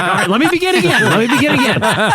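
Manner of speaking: fast, flustered actor starting over. Retold or imitated. Sketch actor to the judging panel, high and hurried. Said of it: "He's like".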